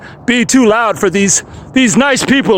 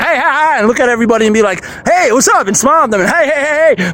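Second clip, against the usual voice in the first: excited greeting; 4 semitones higher, faster than usual. Pretend. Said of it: "I just wanna talk like this and be like".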